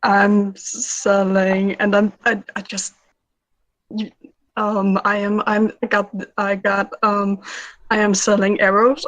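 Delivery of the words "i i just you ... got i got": usual speaking voice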